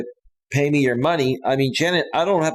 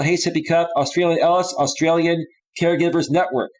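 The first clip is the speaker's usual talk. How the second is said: ordinary delivery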